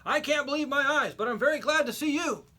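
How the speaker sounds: high, loud amazed voice of a rescued man; man pulled from the water exclaims in relief; astonished rescued man. A narrator's reading. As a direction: marvel at the rescue, grateful.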